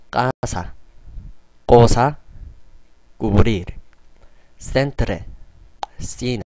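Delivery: 까사 and 꼬사 with a hard ㄲ, 센트레 and 시네 with a lisped th sound; Spanish C demo. Shown as a demonstration. Teacher leading learners through C words, clear demo. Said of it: "같이 발음해볼까요?".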